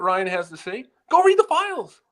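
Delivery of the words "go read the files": emphatic. Real, not acted. Urging listeners, heated.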